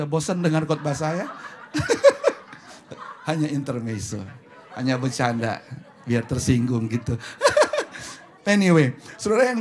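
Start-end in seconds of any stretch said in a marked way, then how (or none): 1.4-3.2 high-pitched burst of laughter; loud teasing laugh. Real, not acted. Laughs at own teasing joke. 7.2-8.4 short, very high burst of laughter; loud joking laugh. Real, not acted. Laughs after saying just joking.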